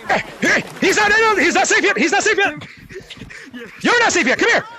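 high, urgent game chatter; excited chase calls. Real, not acted. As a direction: call it out urgently mid-chase. Chasing player not yet safe.

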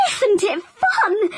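put-on high, slow laughing child's call. Katie calling out while whizzing, high and laughing. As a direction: laugh it out with delight. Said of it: "Katie called".

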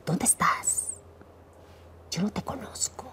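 hushed bitter whisper; defiant whisper to God; whispered challenge. Retold or imitated. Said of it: "empecé a retar a Dios".

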